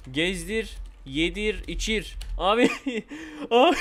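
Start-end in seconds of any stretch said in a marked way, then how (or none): 2.7-3.4 quiet high giggle; laughing. Real, not acted. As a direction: burst into a soft, high giggle. Amused at own baby role-play.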